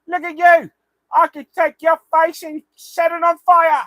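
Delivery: loud, high screeching gnome taunt. Retold or imitated. Gnome under the bridge taunting the player, loud high screech.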